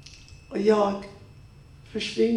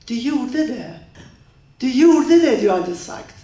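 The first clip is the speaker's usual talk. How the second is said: loud accusation; 2 semitones higher, 5 dB louder than usual. Acted. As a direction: accuse him, rising to a loud shout. She accuses him.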